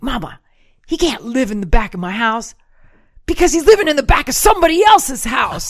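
building to an exasperated shout; angry retort. Retold or imitated. Angry daughter arguing with Mama, exasperated. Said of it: "I remember I was so angry".

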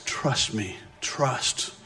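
hushed, near-whispered reassurance; soft, spaced-out words, barely voiced; quiet voice. Quiet voice answering the raging one, hushed. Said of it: "Quiet voice".

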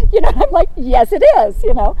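high, loud laughing burst; laughing hard as you tell it. Real, not acted. Laughing retelling poverty story.

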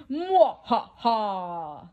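slow evil "mwah ha ha"; mad scientist laugh. Acted. Scientist as the robot comes alive, slow evil laugh. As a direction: laugh like a scheming villain. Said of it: "The scientist laughs".

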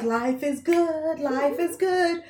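slow, soft, dreamy "life is good"; lazily content, savouring it; mock carefree crowd, drawn out; carefree Israelites.

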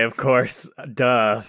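scoffing bluff. Acted. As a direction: bluff loudly as if it were obvious. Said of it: "I say".

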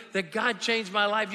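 ordinary talk

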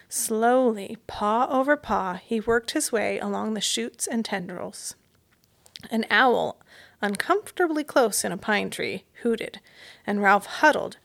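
plain reading voice

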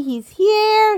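ordinary talk, nothing marked

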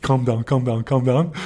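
insistent, repeated plea in a friend's voice; urgent calming. Retold or imitated. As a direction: imitate a pal trying to settle someone down. Retold friend calming him down.

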